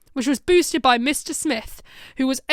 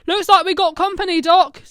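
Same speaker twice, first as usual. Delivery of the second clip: alert warning; 6 semitones higher, 9 dB louder than usual. Acted. Dan warning the Doctor.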